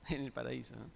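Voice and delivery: breathy laugh through the words. Real, not acted. Preacher answering his own question to the congregation, laughing. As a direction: chuckle the answer out unvoiced.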